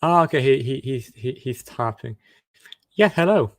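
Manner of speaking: ordinary delivery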